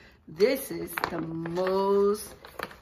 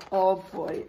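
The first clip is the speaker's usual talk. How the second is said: ordinary delivery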